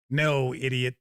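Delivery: ordinary talk, nothing marked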